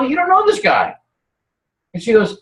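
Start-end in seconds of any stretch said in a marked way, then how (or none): 0.0-1.0 much higher, mock-alarmed coworker voice; mock warning. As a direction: imitate worried coworkers, pitched way up. Coworkers warning her about him.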